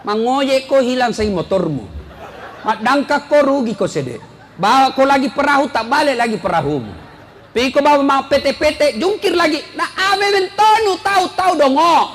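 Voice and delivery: a hint of a harsh wife hurling insults; rude insults. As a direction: berate the husband like a shrewish wife. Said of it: "Kalau kita bicara kasar terus".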